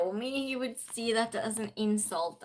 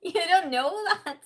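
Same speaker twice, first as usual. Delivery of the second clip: higher, louder amused repeat; teasing contradiction, pitched up and laughing; laughing mock retort; 5 semitones higher, 6 dB louder than usual. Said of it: "I was just".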